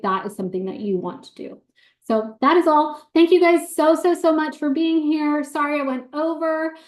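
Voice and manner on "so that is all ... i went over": bright thankful sign-off. Real, not acted. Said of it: "sign-off thanks".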